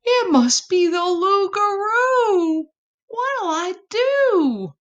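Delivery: high panicky voice; scared. A narrator's reading. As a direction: fret like a trembling little possum. Possum terrified.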